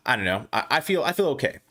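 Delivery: normal talking voice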